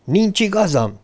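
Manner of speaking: shouting. A narrator's reading. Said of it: "kiabálta".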